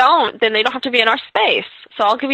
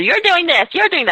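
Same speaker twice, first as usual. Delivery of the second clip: higher, accusing finger-pointing Italian-free tone; 10 semitones higher than usual. Retold or imitated. Telling how she avoided blaming mom, a mock accusing tone. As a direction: blame her, higher and sharper. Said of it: "Instead of".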